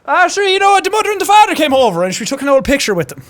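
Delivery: high, fast, broad Irish impression; hammed-up Irish rower; Irish accent. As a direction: rattle it off in exaggerated Irish. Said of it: "this is one of those accents".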